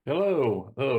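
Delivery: ordinary delivery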